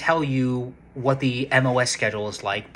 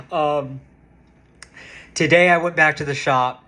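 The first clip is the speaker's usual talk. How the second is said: ordinary talk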